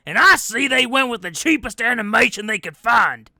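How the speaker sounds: loud, high-pitched cantankerous character voice; Uncle Al. Acted. Uncle Al griping in his holiday podcast message, loud and high. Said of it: "a special message from Uncle Al".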